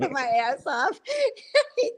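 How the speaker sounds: words breaking up into high laughter; laughing while talking. Real, not acted. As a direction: barely get it out through laughter. Laughing at the memory of friend.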